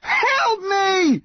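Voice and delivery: high, drawn-out cry for help; desperate shout. A narrator's reading. Son shouting to the yew tree for help. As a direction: yell out, begging. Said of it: "Connor shouted".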